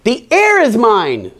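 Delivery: high, slow, drawn-out mock scream. A narrator's reading. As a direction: stretch it out, reaching high. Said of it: "screamed Lydia".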